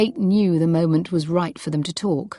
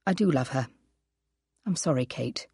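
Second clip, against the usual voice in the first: quiet confession; 4 semitones lower, 5 dB quieter, faster than usual. Acted. Husband confesses affair.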